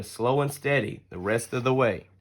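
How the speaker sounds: ordinary delivery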